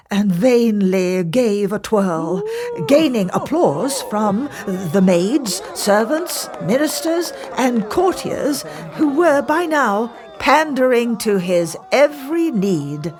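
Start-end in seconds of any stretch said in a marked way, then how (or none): none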